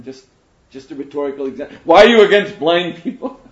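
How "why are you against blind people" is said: loud, raised mimicked protest; mock an outraged heckler, loudly; playfully indignant imitation, raised voice; indignant objection.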